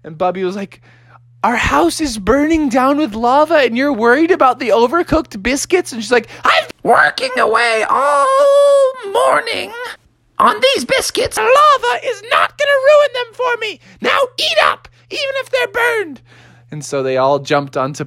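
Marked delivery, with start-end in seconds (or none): 1.4-5.9 higher-pitched disbelieving question; incredulous protest. Acted. Bubby to his mother about the biscuits, incredulous high voice. 6.4-16.2 very high, wailing falsetto; indignant Mother Bear. Acted. Mother Bear defending her biscuits, indignant high falsetto.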